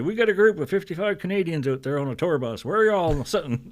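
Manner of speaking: show host calling out. Retold or imitated. MC seeking a Canadian tour group in the audience, calling out. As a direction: imitate an emcee hailing the crowd.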